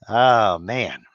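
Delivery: ordinary talk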